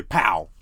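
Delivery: loud, sudden "pow"; imitated gunshot. Dreamer acting out a man shooting someone mid-story, imitated gunshot. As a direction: punch out a gunshot noise.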